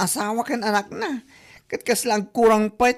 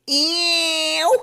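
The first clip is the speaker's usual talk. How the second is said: high, drawn disgusted ew; 6 semitones higher than usual. Acted. Coworker scoffs at borrowing to show off, disgusted. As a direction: recoil in disgust.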